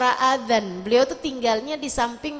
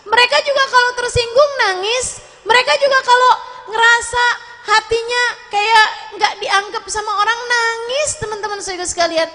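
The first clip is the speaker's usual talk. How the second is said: fast and urgent; 8 semitones higher, 4 dB louder, faster than usual. Real, not acted. Fast rising sermon point.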